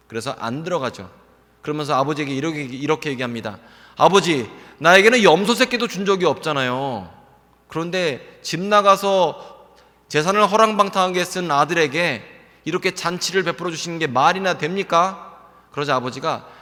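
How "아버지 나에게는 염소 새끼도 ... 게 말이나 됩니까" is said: louder, raised, aggrieved complaint to the father; the older son bristling at the unfair feast; indignant protest. Retold or imitated. Older son protesting the feast to his father, loud and aggrieved.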